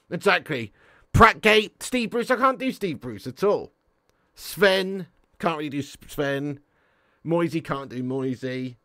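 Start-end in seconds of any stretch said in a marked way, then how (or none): none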